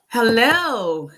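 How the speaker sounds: loud greeting. Real, not acted.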